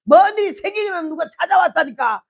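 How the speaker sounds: loud retort. Retold or imitated. Wife yelling back at husband.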